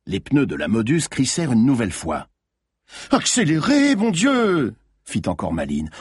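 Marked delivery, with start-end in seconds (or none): 3.1-4.7 high, exasperated order; urgent shout. A narrator's reading. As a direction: shout at the driver for more speed. Yelling at driver during chase.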